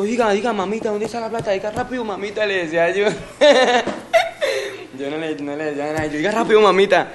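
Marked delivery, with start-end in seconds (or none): 0.0-2.5 high, insistent mock threat; retold demand. Exige la plata a la víctima. 2.5-3.1 words breaking into a laugh. Real, not acted. Se ríe al contarlo. 3.1-4.9 loud, high cackling laugh. Real, not acted. Se ríe al contar. 6.2-7.1 loud, high mock barking; retold demand. Exige la plata a la víctima.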